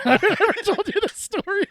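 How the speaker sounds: very high, loud, laughing through the words; laughing confession. Real, not acted. Friend about to confess bath story, laughing hard. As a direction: barely get it out for laughing.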